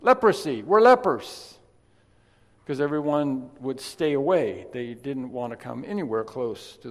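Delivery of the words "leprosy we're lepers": loud high warning shout; desperate outcast yelling to keep people back; lepers yelling warning. Retold or imitated. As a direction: shout them away from you. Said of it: "they would yell".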